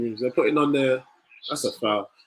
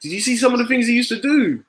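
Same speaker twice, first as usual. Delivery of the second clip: high, incredulous burst of praise; excited disbelief; 10 semitones higher than usual. Real, not acted. Raving to chat about Scholes' old skills, high and incredulous. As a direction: marvel at what he used to do.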